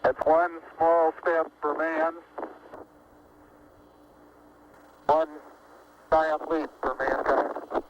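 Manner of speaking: slow, deliberate, measured words; solemn declaration. Real, not acted. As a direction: speak it slowly for history. First step onto the Moon.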